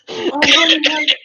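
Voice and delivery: high, loud Southern mom voice; hammed-up Southern "honey" from mom. GM tries out the mom's voice, high hammed-up Southern mom. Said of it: "Southern mom's voice".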